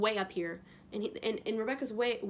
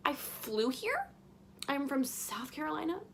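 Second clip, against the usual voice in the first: slow, higher, disbelieving questions; incredulous; 4 semitones higher than usual. Retold or imitated. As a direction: spell out the absurdity slowly. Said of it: "And I'm like".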